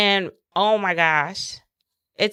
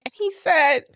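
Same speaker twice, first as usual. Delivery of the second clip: tearful; 6 semitones higher than usual. Real, not acted. Tearful retelling after crying.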